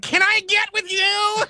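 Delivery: high, slow swooning nerd voice; smitten nerd. Retold or imitated. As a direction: gush it like a lovestruck nerd. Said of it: "it's like".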